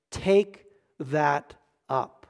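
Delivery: very slow, low and soft; grave, deliberate command. Acted. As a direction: set each word down separately. Weighty quote of Jesus.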